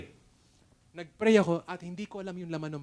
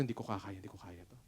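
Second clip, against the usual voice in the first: soft, sinking, near-whispered despair; barely voicing his own defeat; despairing murmur; 8 semitones lower, 15 dB quieter, faster than usual. Pastor recalling his despair, murmured quietly. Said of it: "Sabi ko".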